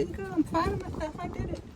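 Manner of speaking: high, delighted and pleased; proud. Real, not acted. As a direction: cheer yourself on. Proud after finishing hose job.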